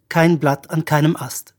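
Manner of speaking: ordinary delivery, nothing marked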